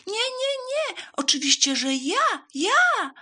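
very high, loud squeal of a mouse; high, insistent mouse cry; eager shout. A narrator's reading. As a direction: outshout everyone as the mouse. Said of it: "zawołała szara mysz".

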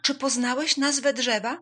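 high, loud question; eager curiosity. A narrator's reading. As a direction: ask eagerly, dying to know. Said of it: "zapytały z ciekawością".

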